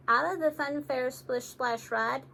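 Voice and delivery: plain reading voice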